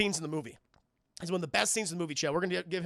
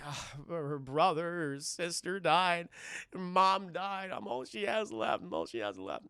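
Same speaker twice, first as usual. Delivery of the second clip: slow, halting mock-mournful plea; hammed-up sorrow, sinking at the end; grieving dad; slower than usual. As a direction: drag it out like a heartbroken father. Acting dad explaining family deaths.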